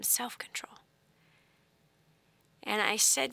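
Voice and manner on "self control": very soft, breath-only whisper; barely audible hushed whisper. Retold or imitated. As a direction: whisper it gently over a child. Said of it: "I whispered it to him".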